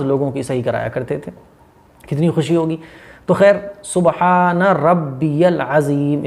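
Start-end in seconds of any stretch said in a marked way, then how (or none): 3.8-6.2 सुबहान रब्बियल अज़ीम very slow, ज़ clearly buzzed; slow, clear recitation. Shown as a demonstration. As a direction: model अज़ीम with a real z sound. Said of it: "इस तरीके से". (अज़ीम में 'ज़' साफ़, बहुत धीरे)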